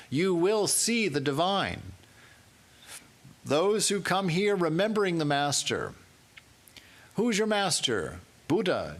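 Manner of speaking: high, loud, ringing proclamation; full-force preaching. Acted. Preacher to congregation, full-force preaching. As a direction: proclaim it to the hall.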